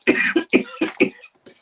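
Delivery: high, rasping cough; hacking cough. Real, not acted. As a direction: cough hard. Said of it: "Excuse me".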